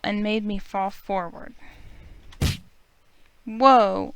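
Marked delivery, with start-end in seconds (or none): 3.5-4.1 startled whoa. Acted. Tripped and falling forward.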